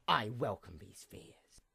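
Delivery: hushed, almost unvoiced confession; whispered admission. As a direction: whisper it to yourself. Villain's private admission.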